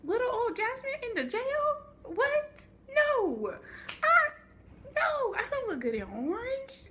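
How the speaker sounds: high, half-laughing mock sob of a scared kid; bawling. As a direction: whimper it like a kid begging not to go to jail. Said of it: "then I started bawling my eyes".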